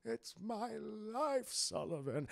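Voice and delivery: put-on slow, soft, gentle old boss voice; imitating a kindly old boss hiding his evil; kindly old man. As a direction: coo it like a sweet old company man. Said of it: "he's this kindly old man".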